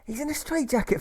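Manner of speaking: very high, exasperated character voice; high-pitched poorly patient; protesting patient. Acted.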